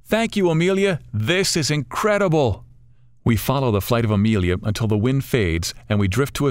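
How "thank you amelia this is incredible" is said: much higher excited calling, slowing on incredible; grateful call out. Acted. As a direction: shout your thanks skyward. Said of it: "I impulsively call out".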